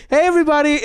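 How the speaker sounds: hammed-up public address; calling out to everyone. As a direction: call the whole staff to attention. Acted address to all staff.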